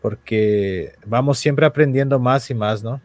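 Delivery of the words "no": usual speaking voice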